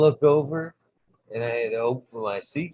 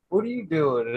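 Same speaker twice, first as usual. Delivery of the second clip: much higher, laughing hello; high amused greeting; 7 semitones higher, 3 dB louder than usual. Retold or imitated. Greeting the toad late at night, high and amused. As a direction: greet the toad like an old pal. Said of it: "I'm like".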